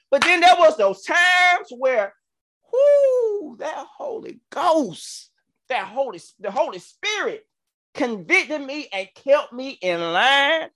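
rising gospel cry, high and loud; preaching. Acted. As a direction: whoop it like a preacher. Preaching about the Holy Ghost.

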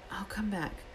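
soft whisper. A narrator's reading. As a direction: breathe it out quietly.

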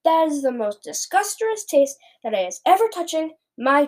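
emphatic, outraged giant; disgusted yell. A narrator's reading. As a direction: rant in revulsion at the taste. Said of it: "yelled the blood bottler".

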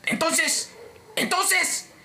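very high, drawn-out comic voice with halting pauses; exaggerated high-pitched apology; mock apology. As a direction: squeak out a hammed-up climb-down. Acted climax of complaint call story.

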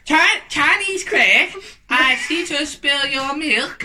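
put-on Chinese accent. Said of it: "You mean Chinese?".

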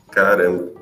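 ordinary talk, nothing marked

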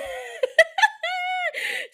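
high-pitched laughing burst; shrieking laugh; high cackling laugh. Real, not acted. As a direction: cackle at your own joke. High laugh at monkeypox bit.

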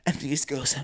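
ordinary delivery

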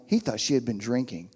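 ordinary delivery

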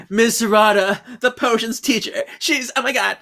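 high-pitched, hammed-up alarm. Student telling on the potions teacher, high-pitched mock alarm. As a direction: blurt it out in a mock panic.

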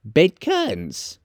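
high, slow, gleeful confirmation; high-pitched, slow woman's voice; amused charwoman. Acted. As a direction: draw it out high, relishing it. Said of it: "laughing and leaning forward".

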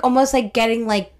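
ordinary talk, nothing marked

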